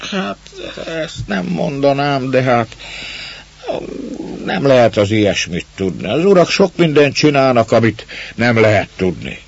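put-on a man dodging the girl's question; evasive gruff man. A narrator's reading. Man dodging the girl's question, evasively.

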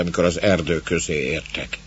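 plain reading voice

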